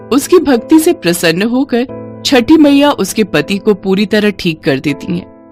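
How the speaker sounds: usual speaking voice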